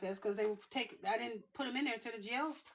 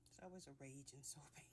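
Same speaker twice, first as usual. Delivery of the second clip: whispering; 6 semitones lower, 16 dB quieter, faster than usual. Real, not acted. Aside while soaping.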